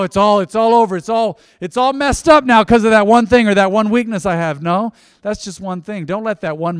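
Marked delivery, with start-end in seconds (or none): 0.0-4.6 loud despair. Acted. Imagined despairing believer's complaint.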